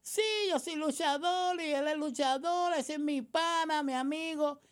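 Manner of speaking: high mock voice of a wrestler bragging; mocking interviewee. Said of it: "uno entrevista".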